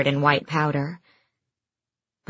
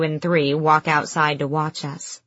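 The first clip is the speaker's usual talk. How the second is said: plain reading voice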